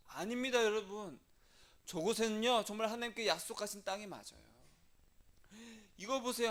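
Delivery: earnest protest. Retold or imitated. Joshua and Caleb contradicting the spies, urgent insistence. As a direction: plead the case to a doubting crowd. Two spies argue against the crowd.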